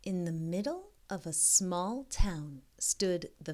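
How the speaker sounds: slow storybook reading voice; story narration. A narrator's reading. Reading a picture book aloud to young kids, gentle storybook narration. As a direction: read it like a bedtime picture book.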